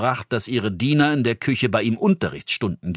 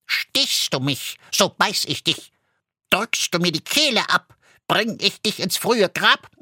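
a threatening goose rhyme, pitched up; talking goose; 8 semitones higher than usual. A narrator's reading. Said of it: "antwortete ganz deutlich und laut".

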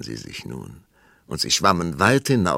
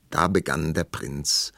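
ordinary delivery

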